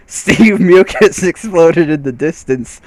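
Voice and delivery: can't hold back the giggles; laughing. Real, not acted. Narrator laughing as the slime explodes.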